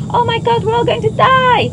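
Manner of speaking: high, loud mock panic; panicked screaming. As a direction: shriek it like a terrified passenger. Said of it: "run up and down the aisles screaming".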